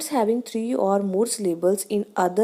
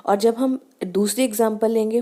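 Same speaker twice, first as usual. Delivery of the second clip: normal talking voice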